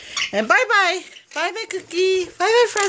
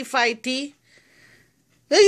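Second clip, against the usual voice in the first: ordinary delivery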